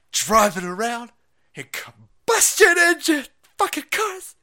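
high loud screaming rant; mock screaming teacher. Mock teacher screaming doom at the children. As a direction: scream it at the class.

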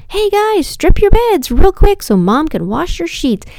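high-pitched mom holler, lightly done; imitated sing-out to the children; calling up to kids. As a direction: call upstairs to the kids. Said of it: "I yell up at the kids".

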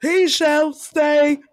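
high, drawn-out outraged English voice; hammed-up English indignation, stretching the words; indignant English accent. Mocking how England would react to losing Charles, indignant English accent. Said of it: "someone from, uh, England is like".

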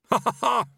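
high, loud fake laugh; desperate, hearty ha-ha-ha; forced laugh. A narrator's reading.

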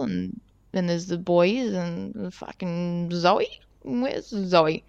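slow down as the count comes up one short; retold dawning worry. Rider scanning the returning group for Zoe, slowly dawning worry.

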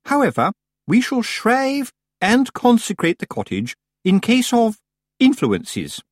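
high, affected and measured; grand pronouncement, slowing on "influences"; pompous society witch. A narrator's reading. Missus Earwig on cleansing the cottage, pompous.

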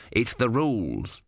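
slow, matter-of-fact reminder; calm small god. A narrator's reading. Om reminding the Sea Queen of the rules, calm. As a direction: lay down the rule coolly.

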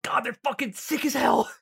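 excited gushing. Real, not acted. To friends about the animation, excited gushing. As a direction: rave about it like a fan.

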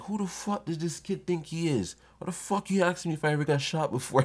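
high-pitched mock outrage; mad older guy. Older guy thrown by a kid's comeback, mock mad. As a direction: imitate an older guy indignant at a kid's question. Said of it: "Why is he getting so mad?".

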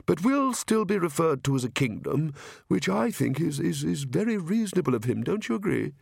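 mild-mannered king explaining politely; careful, slightly dazed royal voice; earnest, harassed king. Acted. King explaining the Count's plan to Nanny, meekly earnest.

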